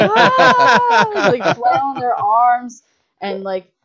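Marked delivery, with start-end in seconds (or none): 0.0-1.3 loud, very high shriek of panic; a grown man screaming while running for the car; terrified scream. Retold or imitated. Men fleeing to the car in pitch blackness, shrieking.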